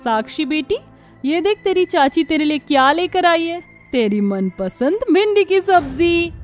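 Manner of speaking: high sing-song enticement; coaxing aunt. Acted. Aunt tempting niece with her favourite dish, coaxing.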